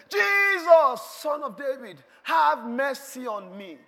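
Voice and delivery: very high, loud cry on the name; howled plea. Acted. Bartimaeus calling past those hushing him, howled plea. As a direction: cry out over the crowd, then slow the plea. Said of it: "shouted, he howled".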